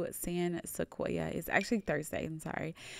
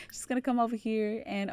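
usual speaking voice

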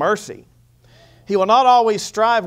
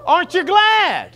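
rousing call; 12 semitones higher, 6 dB louder, slower than usual. Real, not acted. Preacher rousing congregation.

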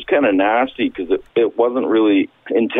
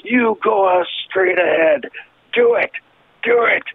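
high, drawn-out mock-crazed yell; wild imitation of a goading friend; daring shout; 10 semitones higher, slower than usual. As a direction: stretch each dare out, pitched way up. Acts out friend daring him.

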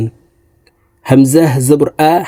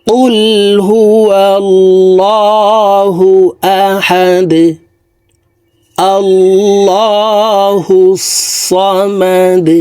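slow, drawn-out melodic tilawat; Quranic recitation; 6 semitones higher, much slower than usual. Acted. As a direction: intone it slowly like a reciter. Recites surah before spelling. (ধীর সুরেলা তিলাওয়াত)

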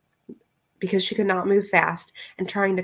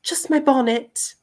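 higher, slow hesitant answer; stammering Rosie; 6 semitones higher, 3 dB louder than usual. A narrator's reading. Rosie naming what she will take, slow and higher. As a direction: answer haltingly in her high voice. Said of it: "she stammered".

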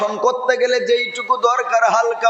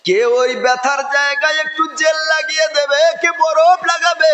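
raised, pleading sermon cry; impassioned preaching; 6 semitones higher than usual. Acted. Preacher builds emotional appeal.

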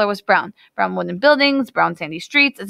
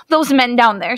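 louder and higher, urgent and pleading; desperate cry; 4 semitones higher, 5 dB louder than usual. Acted. Hannah warning the wedding party, crying out desperately. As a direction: point and beg them to look. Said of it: "she cried out desperately".

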